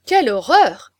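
loud exclamation of horror; outraged chorus. A narrator's reading. The women of the party denouncing Mailly, a loud scandalised outcry. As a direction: exclaim as one scandalised crowd. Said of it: "fit tout d'une voix".

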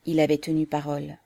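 ordinary delivery

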